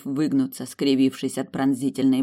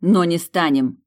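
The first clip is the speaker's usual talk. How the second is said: louder, slow and firm; harsh retort; 3 semitones higher, 6 dB louder than usual. A narrator's reading. Heroine rejecting the demon's suggestion, harshly. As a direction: cut the argument off hard. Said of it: "жёстко отрезала я".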